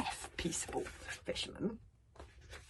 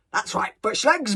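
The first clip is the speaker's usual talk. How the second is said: big, loud approval; booming; 3 semitones higher, 11 dB louder than usual. Acted. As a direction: boom it across to the boy. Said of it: "boomed Gobber".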